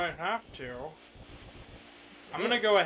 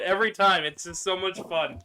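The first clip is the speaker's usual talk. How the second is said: ordinary delivery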